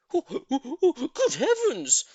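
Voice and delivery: high loud excited monkey squeal with a laugh; excited cry. A narrator's reading. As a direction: squeal in delight. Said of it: "cried all the monkeys".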